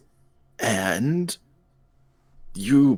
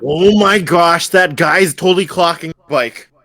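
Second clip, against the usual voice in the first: alarmed exclaim; 4 semitones higher, 7 dB louder than usual. Acted. Friend alarmed that someone is eyeing the bike. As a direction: point out the danger urgently.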